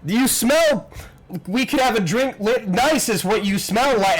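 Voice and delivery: high, gushing babble; infatuated guard. Acted. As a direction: blurt it out, lovestruck and tongue-tied. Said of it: "just sort of infatuated".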